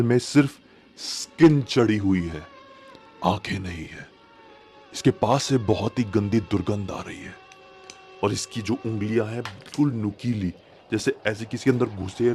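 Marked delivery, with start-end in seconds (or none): none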